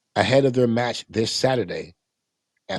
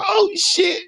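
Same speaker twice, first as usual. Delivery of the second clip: high squeaky astonished gasp of a curse; mock awestruck fan reaction; stunned fans; 3 dB louder than usual. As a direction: play a starstruck fan caught off guard. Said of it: "you see them both go".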